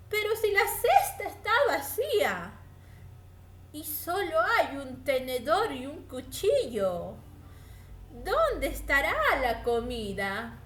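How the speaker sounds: startled, then wondering; puzzled mouse. Acted. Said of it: "la ratita cogió la cesta".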